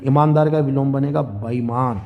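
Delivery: ordinary talk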